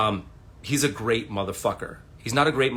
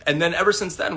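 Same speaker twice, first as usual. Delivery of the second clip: ordinary delivery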